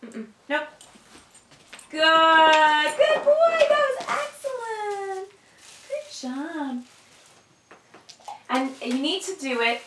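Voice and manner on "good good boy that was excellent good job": high, loud, delighted praise for the dog; high, drawn-out gushing praise to a dog. Real, not acted. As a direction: gush over the dog like it's a triumph.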